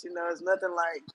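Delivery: normal talking voice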